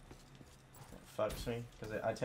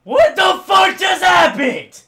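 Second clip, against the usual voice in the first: very loud, high, slow outrage; screamed rage; 17 dB louder, slower than usual. Real, not acted. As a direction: scream it at the top of your voice. Gamer fails again.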